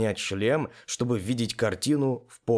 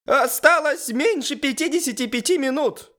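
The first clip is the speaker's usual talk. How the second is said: very high, loud squeaky announcement; pony character voice; 5 dB louder than usual. A narrator's reading. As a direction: pipe up in a very high voice. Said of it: "решился сказать пони". (очень высоким голосом пони)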